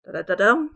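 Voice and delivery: "da-da-da-dum" run lightly into a loud "dum"; metrical foot demo. Shown as a demonstration. Teacher showing types of feet, loud final stress on "da-da-da-dum". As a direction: punch the last beat of "da-da-da-dum". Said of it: "we have different types of feet".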